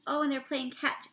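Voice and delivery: usual speaking voice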